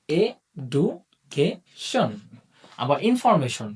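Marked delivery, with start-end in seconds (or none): 0.1-2.1 এডুকেশন stretched very slowly into four syllables. Shown as a demonstration. Teacher to students, stretching এডুকেশন slowly by syllable. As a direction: pull এডুকেশন apart slowly, one beat per syllable. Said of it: "আমরা যদি সাউন্ডের মাধ্যমে বিষয়টাকে বুঝতে চাই".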